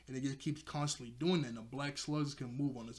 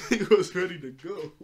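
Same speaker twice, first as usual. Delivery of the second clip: loud, high, laughing through the words; high-pitched real amusement; 5 semitones higher, 10 dB louder than usual. Real, not acted. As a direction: crack up while saying it. Cracking up at Org.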